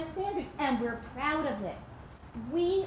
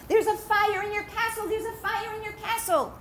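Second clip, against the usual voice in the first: higher, urgent alarm call; alarmed messengers; 8 semitones higher than usual. Acted. Messengers telling the landowner of the fire, alarmed. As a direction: cry out the news.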